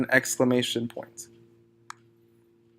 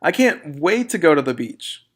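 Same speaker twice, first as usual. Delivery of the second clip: excited exclamation; 2 semitones higher, 5 dB louder than usual. Shown as a demonstration. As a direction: read it with real excitement, louder. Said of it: "So you read it like this".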